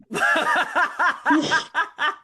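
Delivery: loud high laugh; big burst of laughter. Real, not acted. Friend cracking up at the question, loud laugh. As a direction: laugh out loud, caught off guard.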